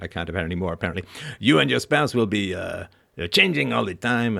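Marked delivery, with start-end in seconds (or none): 1.4-4.4 a lightly voiced French accent that slips toward Quebec; failed French accent. Quoting the French husband's advice, in a shaky French accent. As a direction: attempt the French neighbour's accent, half-failing, some words louder. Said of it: "now I'm from Quebec, apparently".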